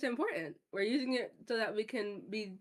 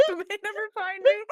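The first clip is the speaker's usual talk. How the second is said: laughing; 12 semitones higher, 9 dB louder than usual. Real, not acted. Echoing a line while laughing.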